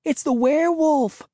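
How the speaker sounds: high, frightened shriek of alarm; screaming out the danger to her friends; high panicked cry; terrified cry. A narrator's reading. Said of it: "Cassie cried".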